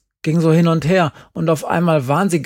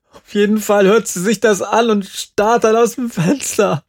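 high, raised, near-tearful outpouring; distraught girl; 5 semitones higher than usual. Acted. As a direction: pour it out, hurt and worked up. Jerry, distraught, retells rejection.

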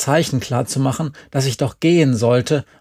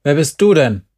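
louder, brusque question; calling over; 5 dB louder than usual. A narrator's reading. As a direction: call the question across to a stranger. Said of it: "rief er mir zu". (laut herüberrufen)

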